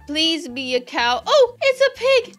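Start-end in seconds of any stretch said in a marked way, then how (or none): 1.3-2.4 high, loud surprised squeal; startled high pitch jump. Real, not acted. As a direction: squeal at the reveal. Hatching reveal, high squeal.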